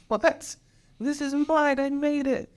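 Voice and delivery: hammed-up childish "it's mine"; possessive child. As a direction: play a kid clutching a toy. Self-quote refusing to hand over work.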